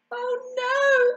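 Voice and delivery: high, tearful little voice; sniffing. A narrator's reading. Ugly duckling left without them, sniffing. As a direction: whimper it as an upset duckling. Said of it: "sniffed the ugly duckling".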